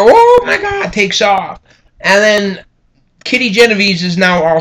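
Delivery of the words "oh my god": startled attacker. Retold or imitated. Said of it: "the attacker".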